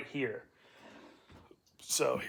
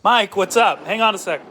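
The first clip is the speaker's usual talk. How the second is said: calling out; 8 semitones higher, 13 dB louder than usual. Real, not acted. Calls out to Mike.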